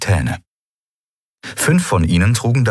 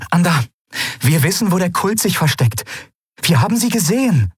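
high-pitched, pressing voice; urgent report; 12 semitones higher than usual. A narrator's reading. She grips his arm to report the enemy, urgently. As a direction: break the news with great urgency.

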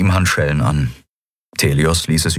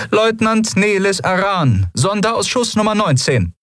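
much higher, formal officer's voice; female lieutenant voice. Acted. Lieutenant announcing herself to the admiral, in a high formal officer voice.

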